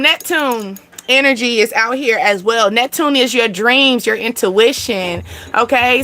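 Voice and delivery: ordinary talk, nothing marked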